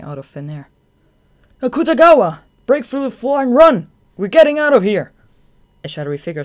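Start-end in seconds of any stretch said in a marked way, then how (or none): 1.6-5.1 very loud, high urgent yell; shouting. Acted. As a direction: yell over the chaos. Yelling an escape order.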